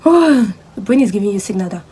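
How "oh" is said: high, loud yawning "oh"; acted yawn of boredom, raised and louder; mock yawn. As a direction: burst into a sudden yawn. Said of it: "all of a sudden you'll be like".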